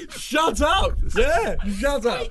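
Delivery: high, loud shout through laughter; laughing shout. Real, not acted. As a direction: yell it out between laughs. Laughing protest at friend.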